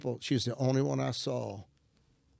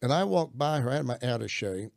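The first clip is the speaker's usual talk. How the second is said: ordinary talk, nothing marked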